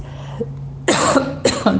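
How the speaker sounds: harsh cough; choking cough; coughing fit. Real, not acted. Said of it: "eu tô tossindo toda hora".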